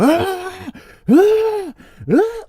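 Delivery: very high-pitched demon "ah" in short bursts; demonic possessed wail. Shown as a demonstration. Commentator demonstrating possessed anger, high demonic cries. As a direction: mimic someone taken over by a demon with high cries. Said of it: "like it's being taken over by, like, some type of demon".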